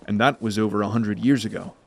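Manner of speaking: plain reading voice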